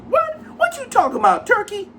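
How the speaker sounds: very high, indignant falsetto caricature of a woman; shrieking mock outrage up in falsetto; caricature Layla voice.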